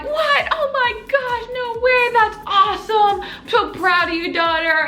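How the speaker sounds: hammed-up overjoyed parent; imitating thrilled dad. Acts out thrilled dad's reaction.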